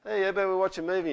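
high, quick, loud husband; husband interrupting. Acted. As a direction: pipe up brightly with a casual suggestion. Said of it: "Your husband comes along and goes".

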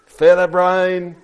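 slow, loud storybook insult; hissing snake. A narrator's reading. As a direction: stretch out the insult, high and loud. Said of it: "hissed Snake".

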